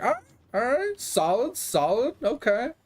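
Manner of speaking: lightly mocking, high "all right, solid, okay"; mock impressed. To the gym guy showing off his max, mock impressed. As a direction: pat him on the head with fake praise.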